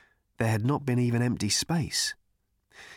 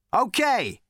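much higher, loud brash call; Zaphod's cry; 11 semitones higher, 4 dB louder than usual. A narrator's reading. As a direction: shout it cocky and heroic. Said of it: "he cried".

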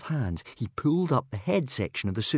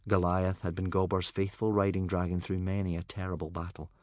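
plain reading voice

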